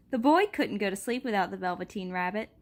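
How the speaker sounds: ordinary delivery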